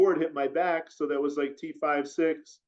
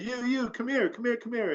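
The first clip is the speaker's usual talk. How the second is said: calling out; 8 semitones higher than usual. Retold or imitated. Stranger calling him over.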